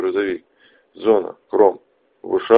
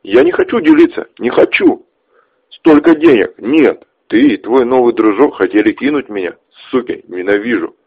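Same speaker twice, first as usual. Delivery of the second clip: angry; 4 semitones higher, 6 dB louder than usual. Acted. Ches screams in greed.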